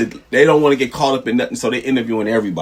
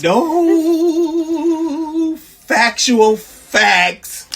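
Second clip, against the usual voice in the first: drawn-out shout; much slower than usual. Acted. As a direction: stretch it out like a chant.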